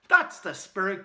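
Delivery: loud, high praise; delighted cry. Acted. Boy praising the caveman's new word, delighted. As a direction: cheer him on. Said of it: "cried Connor".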